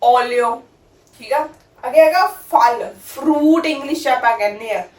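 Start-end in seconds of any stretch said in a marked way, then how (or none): 0.0-0.6 ਓਲੀਓ begun on a round O, never ਆਲੀਓ; careful ਓਲੀਓ with a clear opening O; Italian olio with O. Shown as a demonstration. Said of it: "ਊੜੇ ਦਾ ਮੂੰਹ ਖੁੱਲ੍ਹਾ". (ਓਲੀਓ ਖੁੱਲ੍ਹੇ ਓ ਨਾਲ ਬੋਲੋ)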